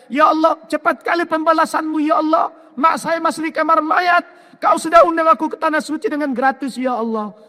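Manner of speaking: high, shouted tearful thanks to God; crying prayer. Retold or imitated. Son thanking Allah through tears at the morgue, high shouted. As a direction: cry out to Allah overwhelmed. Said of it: "nangis saya".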